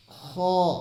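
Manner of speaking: खौ said heavy, with a full, rounded mouth; full-mouthed kha. Shown as a demonstration. Teacher showing viewers a full-mouthed खौ. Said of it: "इसको मुंह भर के पढ़ना है".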